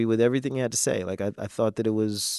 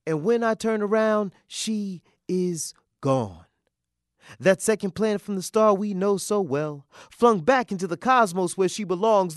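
rhythmic, lilting verse, often raised in pitch; spoken-word poem; 11 semitones higher, 4 dB louder than usual. Acted. Father watching Venus on television with his daughter, spoken word. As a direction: perform it as reflective spoken word.

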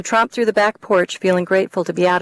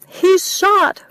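high, loud panicked cry; panicking; 12 semitones higher, 4 dB louder than usual. Acted. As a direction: tip back into panic. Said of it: "jumped on the panic wagon again".

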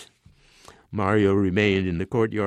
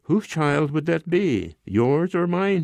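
slow, higher-pitched disdainful question; 7 semitones higher, slower than usual. A narrator's reading. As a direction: draw the challenge out with scorn. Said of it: "he asked disdainfully".

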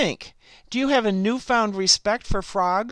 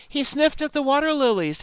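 usual speaking voice

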